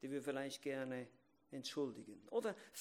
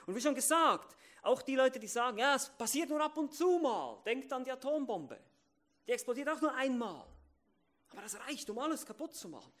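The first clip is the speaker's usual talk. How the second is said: loud, high, urgent warning; preaching at full force; 10 semitones higher, 9 dB louder than usual. Acted. Preacher warning the congregation about rare outbursts, loudly and urgently. Preacher warns with atom bomb.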